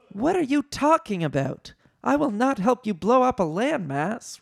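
high, indignant refusal; appalled high character voice; Leia. A narrator's reading.

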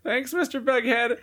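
squeaky high cartoon voice. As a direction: pitch way up for a silly cartoon. Voicing cartoon characters.

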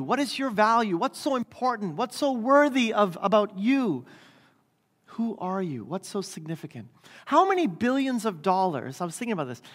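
ordinary talk

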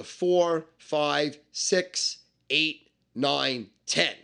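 each number from "four" to "ten" set apart by pauses; one by one. Shown as a demonstration. Explaining dice to a beginner, "four" through "ten" spaced one by one. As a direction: count out "four, five, six, eight, nine, ten" deliberately.

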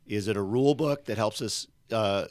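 ordinary talk

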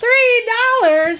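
high, drawn-out pretend astonishment; mock amazement. Co-host reacting to the sponsor's price, in mock amazement. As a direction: squeal it in hammed-up disbelief.

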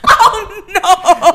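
high, loud, laughing dismay; mortified but cracking up; laughing protest. Real, not acted. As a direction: protest through helpless laughter. Teased about the photo.

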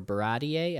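"Baratie" as buh-RAH-dee-ay; alternative pronunciation. Shown as a demonstration. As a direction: end "Baratie" on an added ay syllable. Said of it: "I don't know how to say it".

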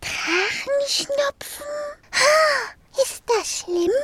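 put-on a small girl gasping in alarm; startled. Marie hears of dragon cold, startled high girl voice. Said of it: "Erschreckt schaute ihn".

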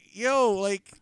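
high, loud incredulous retort; mock exasperation. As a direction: act out calling the stranger out, exasperated. Acted reaction to the seller.

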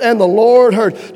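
"And the Lord heard." repeated slowly, stress on "Lord"; slow the repeat and land on "Lord"; emphatic repeat. Shown as a demonstration. Preacher repeating the verse so the congregation gets it, slow and emphatic. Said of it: "I want you to get that".

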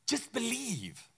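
slow, soft, hushed just believe; a tender, slow plea to trust; gentle urging. Retold or imitated. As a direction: coax it out quietly. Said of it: "Jesus is looking and saying".

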